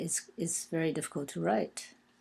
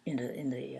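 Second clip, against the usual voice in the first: ordinary talk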